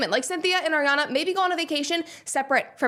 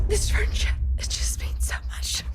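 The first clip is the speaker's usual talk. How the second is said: higher then fading to a whisper, mock emotional; mock teary confession; 8 semitones higher than usual. Vlogger parodying the stars' teary interview, mock whisper. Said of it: "Can you imagine me, like, sitting on camera?".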